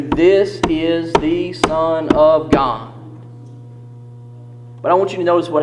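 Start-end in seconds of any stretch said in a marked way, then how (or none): 0.0-2.9 slow, weighty stress on every word; very emphatic. Retold or imitated. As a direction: declare it word by word, drawn out and firm. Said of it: "very emphatically".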